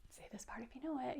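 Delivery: fast soft unvoiced cue; whisper. Real, not acted. Reader whispers to the children to join the refrain. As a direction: hush it, then invite them in.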